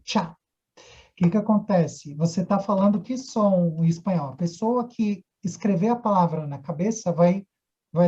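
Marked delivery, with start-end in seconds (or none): none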